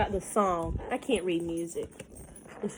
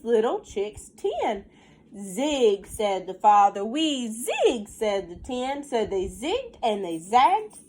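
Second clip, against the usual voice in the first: lilting storybook read, slowed on the rhymes; reading to children; 3 semitones higher, 5 dB louder, slower than usual. Acted. Teacher reading a counting rhyme to her class, sing-song storybook voice. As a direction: read the rhyme aloud to the little ones. Reads picture book aloud.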